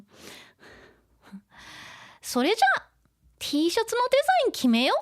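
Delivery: high, loud, fast character reading; animated anime girl. Acted. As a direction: act out a bubbly anime girl in Japanese.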